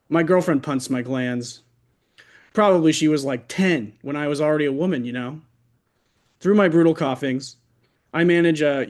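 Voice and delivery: ordinary delivery, nothing marked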